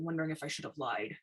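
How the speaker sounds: ordinary delivery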